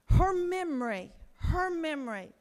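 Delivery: choking up over the survivor's memory; voice breaking. Real, not acted. Telling lawmakers about the crash, voice cracking with emotion. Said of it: "I get so emotional about this".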